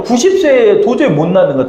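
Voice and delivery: ordinary talk, nothing marked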